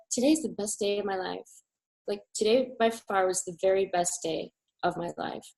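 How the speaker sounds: quietly overwhelmed; hushed awe. Retold or imitated. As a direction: tell her softly, full of awe. Quiet awed answer to instructor.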